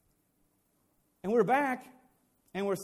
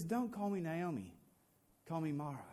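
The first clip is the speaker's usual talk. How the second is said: softer, low and bitter; 3 semitones lower, 9 dB quieter than usual. Acted. Naomi refusing her old name, quietly bitter. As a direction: turn the welcome away sadly. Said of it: "And she says".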